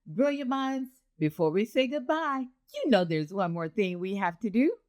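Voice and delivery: ordinary talk, nothing marked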